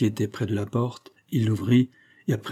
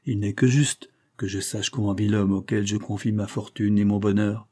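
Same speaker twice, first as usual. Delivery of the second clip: usual speaking voice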